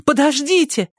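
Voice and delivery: sudden cry. A narrator's reading. Tearful girl suddenly cries out to wait. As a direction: call out suddenly to stop them. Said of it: "вдруг вскрикнула Эллисон".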